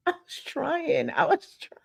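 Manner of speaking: high-pitched laughing self-defense; squeaky, amused excuse-making; playful protest. Real, not acted. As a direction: plead your case in a high voice through laughter. Mock-pleading excuse, laughing at herself.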